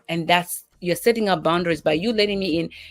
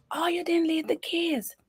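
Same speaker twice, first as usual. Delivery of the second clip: panicky daughter; 10 semitones higher than usual. Retold or imitated. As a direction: imitate a flustered kid who's locked out. Daughter voiced, panicking over keys.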